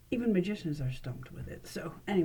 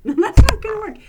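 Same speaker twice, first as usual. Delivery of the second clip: much louder, high, real laugh; laughing at a flop; 13 semitones higher, 17 dB louder than usual. Real, not acted. Host laughing as the trick fails on camera. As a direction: burst out laughing at the mistake.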